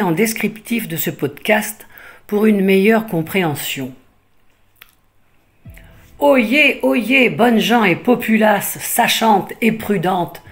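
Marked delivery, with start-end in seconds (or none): 6.2-10.4 town crier. Acted. Mock proclamation to the listeners.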